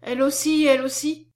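high, loud repeated exclamation; astonished. A narrator's reading. Nurse astonished at the name change.

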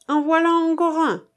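high, loud character voice; loud, mocking acted announcement; public denunciation. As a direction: call out to the crowd against him. Denounces former friend to the crowd.

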